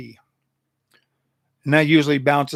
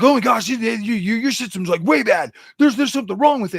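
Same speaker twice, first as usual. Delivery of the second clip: higher and louder, a mocking complainer; hammed-up commenter whine; mock whiny commenter; 6 semitones higher, 5 dB louder than usual. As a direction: parody a commenter griping about the build.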